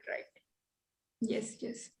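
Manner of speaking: ordinary talk, nothing marked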